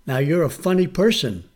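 normal talking voice

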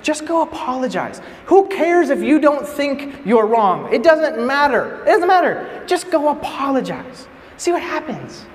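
high, drawn-out pleading insistence; exasperated advice. Retold or imitated. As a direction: urge him as if it's obvious. Friends urging him to apologize.

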